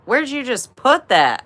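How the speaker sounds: high, laughing, incredulous question; a laugh breaking through the shock; laughing disbelief. Retold or imitated. Teen watching sister snort, laughing in disbelief.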